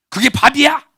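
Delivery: loud, high scornful jab; mock husband scolding. Husband sneering at his wife's cooking, loud and scornful.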